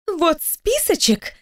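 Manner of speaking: high, drawn-out indignant protest. A narrator's reading. Employee waving a paper at her boss, indignant. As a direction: pitch it up and stretch it in indignation. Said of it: "продолжая возмущаться".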